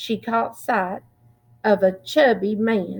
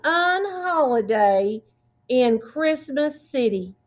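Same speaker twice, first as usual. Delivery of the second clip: ordinary delivery, nothing marked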